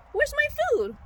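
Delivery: very high put-on sheep voice; indignant hungry sheep. Sheep at feeding time without shepherd, high mock distress. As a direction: whine for dinner as a comically worried sheep.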